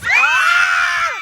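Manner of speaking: very high, sustained scream; shrill scream. Real, not acted. Vlogger at the end of a chant, high scream. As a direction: let out one long, piercing shriek.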